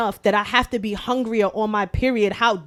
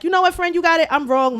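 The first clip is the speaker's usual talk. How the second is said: higher, breezy mock concession; mock apology; 6 semitones higher, faster than usual. Telling how she would have conceded to her friend, in a mock breezy tone. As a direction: toss off a fake apology.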